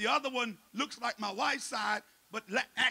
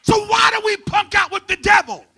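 loud, higher fiery sermon shouting; preaching at full force; 9 semitones higher, 15 dB louder than usual. Acted. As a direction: preach fire into the church.

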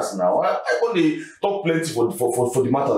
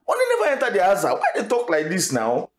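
protesting; 7 semitones higher than usual. Acted. Protesting at demand for money.

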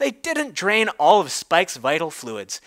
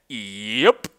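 lower, sheepish stallion voice; sheepish Big Mac; 9 semitones lower than usual. Acted. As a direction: back down lower, a big pony apologising. Big Mac's catchphrase voiced.

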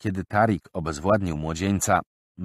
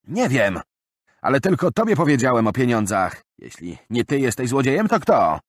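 higher, louder accusing question; suspicious robbed man; 6 semitones higher, 6 dB louder than usual. Acted. Bill accusing his friend, upset. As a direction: press him for an answer.

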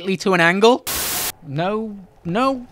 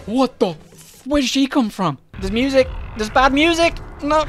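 startled fear; 6 semitones higher than usual. Real, not acted. Sudden enemy appears.

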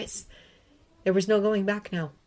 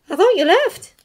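put-on higher, louder relieved young woman; Hermione's voice; 13 semitones higher, 9 dB louder than usual. A narrator's reading. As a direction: call out with relief, pitched up.

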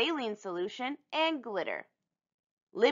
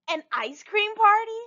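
excited squeal; 13 semitones higher, 4 dB louder than usual. Acted. Libby dreaming of the prize.

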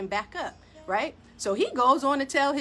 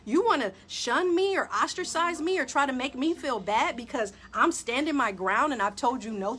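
ordinary talk, nothing marked

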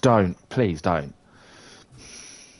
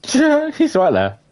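slow, high, laughing through the words; laughing disbelief; 13 semitones higher, 5 dB louder, much slower than usual. Real, not acted. Player spotting someone in the game, laughing. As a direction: giggle it out, stretched slow.

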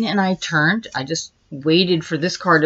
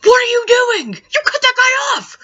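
much higher, louder frantic shout imitating the brother; 4 dB louder than usual. Brother shouting at her for cutting a driver off, frantic. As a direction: scream it in a panicked accusation. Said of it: "my brother ... was like".

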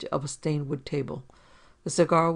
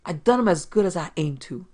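louder, snarled retort; snarling landlady; 2 semitones higher, 6 dB louder than usual. A narrator's reading. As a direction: snap it back at him like a tired old landlady. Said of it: "The woman snarled".

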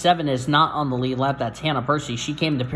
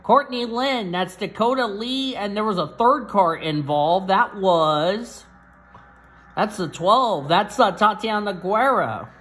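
excited; 8 semitones higher than usual. Real, not acted. Naming cars in the crash.

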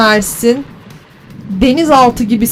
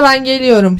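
ordinary talk

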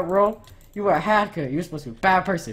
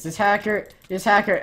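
ordinary talk, nothing marked

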